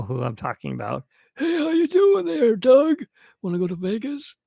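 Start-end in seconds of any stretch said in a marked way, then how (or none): 1.4-4.3 much higher, louder put-on voice; Choco's voice. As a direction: greet like the goofy neighbour Choco. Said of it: "Choco came around".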